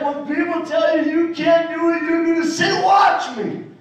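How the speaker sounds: retold rousing command; imitated mom's rallying. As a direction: fire up the kid like a coach. Mom voiced coaching, building up.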